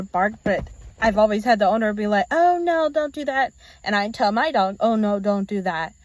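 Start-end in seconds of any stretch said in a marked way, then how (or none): 2.3-3.5 high scolding. Retold or imitated. Other owner scolding dog.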